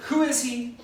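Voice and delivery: high, loud demand; riled up. Retold or imitated. King Xerxes, enraged, demanding who did it. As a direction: demand an answer sharply. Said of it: "She's all riled up".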